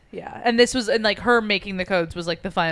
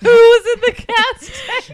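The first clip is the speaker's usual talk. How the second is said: high, loud squeal through laughter; laughing uncontrollably; 10 dB louder than usual. Real, not acted. Co-host cracking up at the gas tank question, laughing squeal. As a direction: drag the words out, squealing with laughter.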